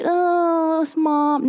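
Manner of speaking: high, drawn-out whine; whiny little one. Acted. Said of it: "You're not like".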